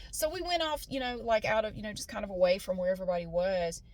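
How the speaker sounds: normal talking voice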